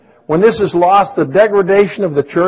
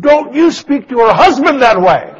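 loud, high-pitched indignant retort; retold angry outburst; 10 semitones higher, 8 dB louder than usual. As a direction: yell back as an offended husband in a re-enactment. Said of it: "I got mad. I said".

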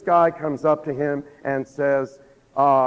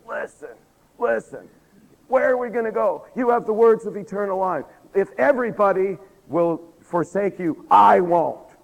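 higher, insistent pledge building to a loud finish; insistent vow; 6 semitones higher, 5 dB louder than usual. Retold or imitated. Disciple pledging loyalty to the Lord, insistent and building. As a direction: vow loyalty, ending on an emphatic burst.

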